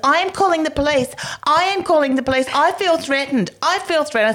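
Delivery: very high, shrill-pitched mimicry of panic; imitating the shop owner calling police; mock hysterical shop lady. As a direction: squeal it like a frightened snob.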